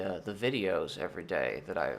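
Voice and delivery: normal talking voice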